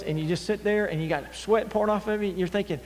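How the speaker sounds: usual speaking voice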